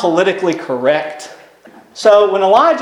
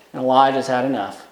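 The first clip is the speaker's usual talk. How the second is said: ordinary talk, nothing marked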